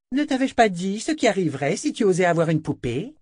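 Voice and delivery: high-pitched princess scolding her servant; bullying princess, menacing; haughty princess. Acted. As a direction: scold in a high, imperious girl's voice. Narrator voices the bullying princess.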